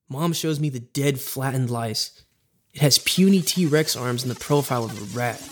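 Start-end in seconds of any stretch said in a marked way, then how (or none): none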